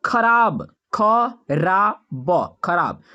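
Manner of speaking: ordinary talk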